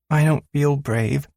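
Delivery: put-on a six-year-old clinging to the handlebars; scared little girl. Little daughter telling her father she's scared, in a high child voice.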